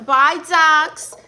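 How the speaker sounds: sudden loud farewell thrown in mid-sentence; calling out goodbye. Real, not acted. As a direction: call it out to someone leaving. Calls farewell to departing viewer.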